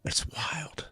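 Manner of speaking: unvoiced whisper of amazement; hushed awe. Real, not acted. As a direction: drop to a whisper, marvelling. Hushed awe closing his point.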